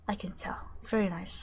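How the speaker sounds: whisper. Real, not acted.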